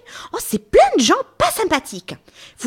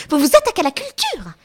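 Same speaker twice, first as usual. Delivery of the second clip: usual speaking voice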